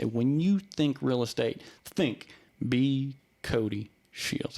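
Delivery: put-on slow, drawn-out old-school salesman; imitating his mentor's slow drawl; super slow. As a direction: stretch every word like molasses. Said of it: "That's how he talks, super slow".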